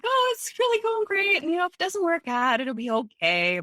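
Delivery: forced cheerfulness. Retold or imitated. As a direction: pretend all is fine while hiding tears. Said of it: "me being the nice person I am".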